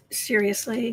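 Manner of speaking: ordinary talk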